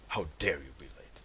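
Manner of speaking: whispered, mock-stern reproach; mock whisper scold. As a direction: hiss the scolding like a pretend angry boss. Mock scolding a late viewer.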